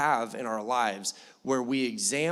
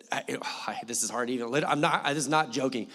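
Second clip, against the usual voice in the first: usual speaking voice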